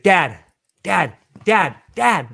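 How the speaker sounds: loud repeated call, rising each time. Retold or imitated. Daughter calling her distracted dad, loud insistent repeated call. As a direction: call him over and over to get attention.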